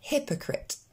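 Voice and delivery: "hypocrite" with short è-like vowels, HEP-o-cret; pronunciation model. Shown as a demonstration. Teacher avoiding the learners' I tendency, pronunciation model. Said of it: "Encore une fois".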